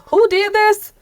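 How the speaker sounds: high, excited mock disbelief; amazed question. Peers across the country asking who funded this, astonished. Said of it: "people ... who are saying".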